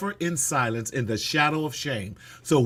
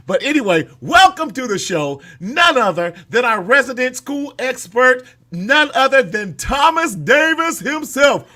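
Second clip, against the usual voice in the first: loud, high announcer build-up; hype intro; 9 semitones higher, 7 dB louder than usual. Real, not acted. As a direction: announce him like a big star.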